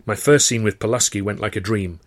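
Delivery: ordinary delivery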